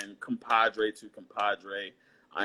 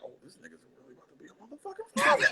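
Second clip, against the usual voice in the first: stunned realization; much faster than usual. Retold or imitated. Friend's inner realization voiced.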